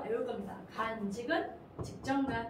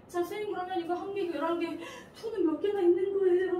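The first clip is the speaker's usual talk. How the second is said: high, fast, louder student question; student asking; 6 semitones higher, 5 dB louder than usual. Acted. Student asking teacher how many verbs, high and insistent. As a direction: pipe up urgently, building louder to the end.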